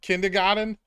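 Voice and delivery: Kindergarten said the Boston way, r dropped as kindah-gahden; Boston accent. Shown as a demonstration. Asked by a chat viewer for a Boston accent, Kindergarten in Boston accent. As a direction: drop the r's Boston style in Kindergarten. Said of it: "Can you say kindergarten in a Boston accent".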